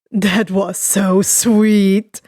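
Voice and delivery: slow teasing drawl, low; sweet-talking Dabi. A narrator's reading. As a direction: tease him slowly, sing-song. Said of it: "the cremation user said".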